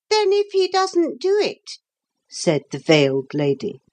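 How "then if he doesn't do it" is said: very high, thin challenger; thin, disagreeable voice. A narrator's reading. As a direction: cut in with a high, disagreeable squeak. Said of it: "a thin and disagreeable voice".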